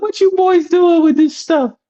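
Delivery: high, slow Southern grandmother; Southern accent. Retold or imitated. Grandmother catching boys with a magazine, high Southern accent. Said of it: "she had that Southern talk".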